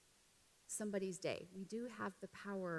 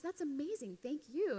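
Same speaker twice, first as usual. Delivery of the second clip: higher, gushing gratitude; imitated delighted thanks; 6 semitones higher than usual. Flight attendant thanking a passenger for chocolates, imitated delight. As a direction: play an impressed, thankful recipient.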